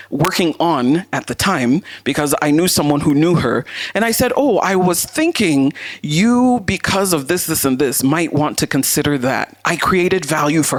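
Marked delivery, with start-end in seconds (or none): none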